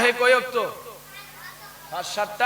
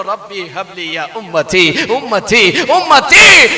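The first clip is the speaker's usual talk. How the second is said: building plea; 8 dB louder, slower than usual. Retold or imitated. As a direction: plead it, each repeat more forceful. Prophet's repeated plea, acted.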